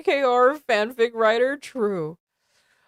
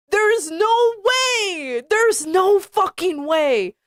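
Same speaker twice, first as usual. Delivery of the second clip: exasperated disbelief; 6 semitones higher, 3 dB louder, slower than usual. Real, not acted.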